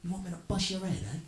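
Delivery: hammed-up thug threat. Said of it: "He's like".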